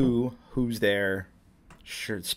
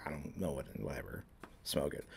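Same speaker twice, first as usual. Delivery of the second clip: low and quiet, careless; mumbled shrug; 8 semitones lower, 16 dB quieter, faster than usual. Retold or imitated. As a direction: toss it off indifferently. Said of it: "and I'm like".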